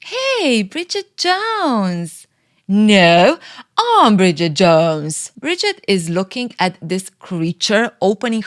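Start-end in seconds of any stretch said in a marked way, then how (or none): 0.1-2.3 high, drawn-out sneering call; mocking rival. Rival Becky greeting Bridget at the door, high and drawn-out mock. 2.7-5.1 loud, slow insistence on I'm; indignant reply. Retold or imitated. Bridget correcting Becky at Mark's door, loud and slow.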